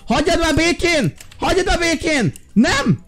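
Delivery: high, urgent yells to leave the dog alone; protective yell. Real, not acted. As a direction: shout at him to back off. Defending his dog, yelling. (magas, kétségbeesett kiabálás)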